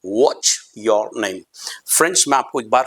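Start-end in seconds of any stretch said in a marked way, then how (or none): 0.1-1.4 What's your name said very slowly and clearly; slow example. Shown as a demonstration. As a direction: model the question slowly. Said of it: "आपने हमेशा सुना होगा". (धीमा, साफ़ उदाहरण)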